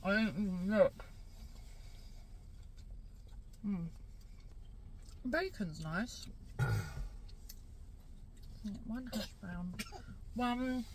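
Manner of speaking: eating in between words; chewing while talking. Real, not acted. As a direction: mouth partly full. Seen 1: Holds wrap, lips closed chewing, hand feeds mouth at 0.8s.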